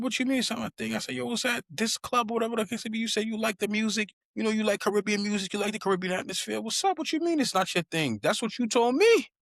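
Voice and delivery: very high, fast, rising outrage; incredulous disbelief. Retold or imitated. Arguing with the neighbor, very high and incredulous. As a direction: refuse to accept his answer.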